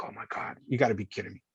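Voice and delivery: breathless whispered disbelief. Retold or imitated. Trapped officer hearing rescuer's voice, awed whisper. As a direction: whisper it in awe. Retold inner thought, whispered.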